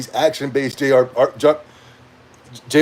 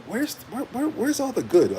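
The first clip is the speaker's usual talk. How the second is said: much softer, searching mumble; hushed puzzlement; 8 semitones higher, 7 dB quieter than usual. Retold or imitated. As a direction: mumble it as if to yourself. Muttering own reaction.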